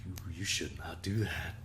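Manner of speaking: soft unvoiced warning; whispered scolding. Chat scolding Twitch, in a whisper. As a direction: hush them with a whispered warning. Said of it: "they were like".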